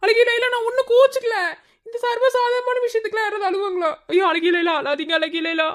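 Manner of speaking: high-pitched, panicky consoling; pleading, self-blaming dismay; flustered distress. Acted. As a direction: fuss anxiously to stop someone crying. Panicking because she is crying.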